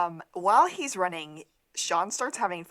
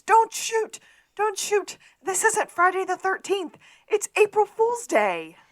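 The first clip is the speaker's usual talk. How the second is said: very high, loud frantic shout; imitated scream; 13 semitones higher, 8 dB louder than usual. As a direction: scream it out as the character, pleading to stop. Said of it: "stand up and screams".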